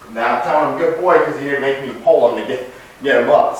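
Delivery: ordinary delivery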